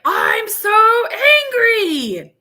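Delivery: high, loud roaring giant; angry giant shout. Retold or imitated. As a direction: bellow it like a cartoon giant. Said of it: "where the giant is like".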